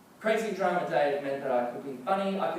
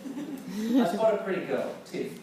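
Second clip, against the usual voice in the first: ordinary delivery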